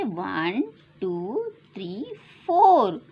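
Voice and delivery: usual speaking voice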